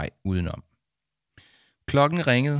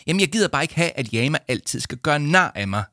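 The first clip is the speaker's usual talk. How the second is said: put-on higher, forceful girl's grievance; tense, aggrieved protest; tight, sour complaint; 7 semitones higher, 5 dB louder than usual. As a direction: complain tersely, voice pushed up. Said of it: "sagde Victoria stramt".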